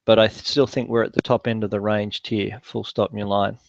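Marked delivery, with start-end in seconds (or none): 2.6-3.6 quieter dictation command aside; dictating. Real, not acted. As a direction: drop the voice to dictate punctuation. Dictating punctuation to software.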